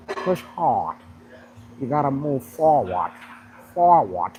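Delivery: hammed-up Southern coach urging you on; imitating Lee Haney's Southern drawl. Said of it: "he talk like this".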